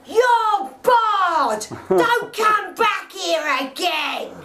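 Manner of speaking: high, loud, drawn-out banning yell; re-enacted shout. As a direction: bellow it down the street as the shopkeeper. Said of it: "She belted out the shop and shouted".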